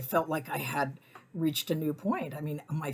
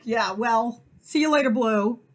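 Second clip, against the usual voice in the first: louder, dry goodbye; sarcastic brush-off; 6 semitones higher, 7 dB louder than usual. Retold or imitated. Narrator dismissing the pit bull owner, sarcastic and louder. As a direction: send them off with sass. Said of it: "I'm like".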